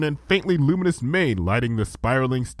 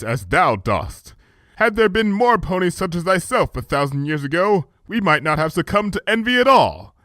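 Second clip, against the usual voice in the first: higher, louder archaic royal voice; regal princess; 8 semitones higher, 3 dB louder than usual. A narrator's reading. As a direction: speak as a gracious ancient monarch. Luna's regal voice.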